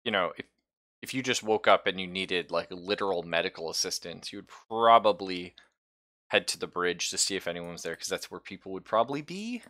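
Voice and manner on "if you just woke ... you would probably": ordinary talk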